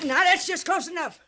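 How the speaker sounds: high, loud startled warning; sharp warning. Acted. Hattie catching the men sneaking up, a sharp high warning. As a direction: stop them cold with a sharp cry.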